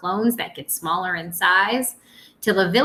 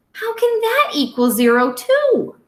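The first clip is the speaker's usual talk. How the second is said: puzzled question; 13 semitones higher than usual. Acted. Puzzled child questions math.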